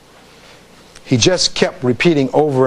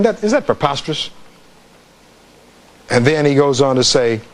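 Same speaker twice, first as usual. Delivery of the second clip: usual speaking voice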